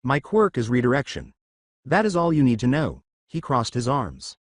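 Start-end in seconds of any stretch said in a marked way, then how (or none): none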